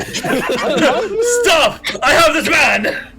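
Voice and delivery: laughing mock cop yell; laughing shout.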